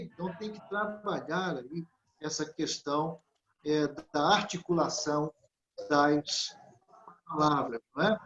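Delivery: normal talking voice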